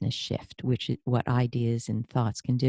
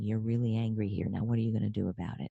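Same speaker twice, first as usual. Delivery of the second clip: softer and lower, self-talk; quiet inner voice; 4 semitones lower, 7 dB quieter, faster than usual. Retold or imitated. The host voicing her body's quiet warning to herself. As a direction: calmly question yourself. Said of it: "my way of saying".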